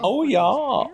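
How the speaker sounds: high German-dub Patrick echo; german dubbing voice. Retold or imitated. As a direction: copy the dopey German starfish. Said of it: "my German Patrick".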